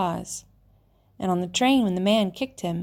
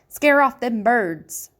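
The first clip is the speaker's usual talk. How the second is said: put-on bossy old woman; 4 semitones higher, 5 dB louder, slower than usual. A narrator's reading. Old woman orders scarecrow.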